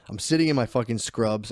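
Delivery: normal talking voice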